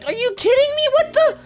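high, squeaky protest; exasperated disbelief. Real, not acted. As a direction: protest shrilly, can't believe it. Exasperated at failed jump.

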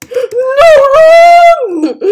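very high, very loud scream; panicked scream. Real, not acted. Player fleeing in the game, panicked scream.

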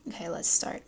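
soft whisper to herself; whispered start. Real, not acted. Vlogger about to begin the French lesson, whispering. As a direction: breathe it out quietly before diving in.

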